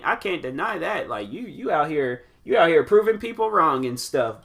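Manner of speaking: usual speaking voice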